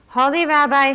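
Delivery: high, loud wail to the rabbi; poor man wailing for the rabbi. A narrator's reading. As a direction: wail out his name in despair. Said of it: "he wailed".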